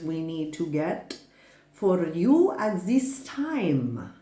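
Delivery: slow, drawn-out words in a vague German accent; accidental German accent. Real, not acted. Reader offering tarot messages slips into a German accent. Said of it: "Why am I talking like that?".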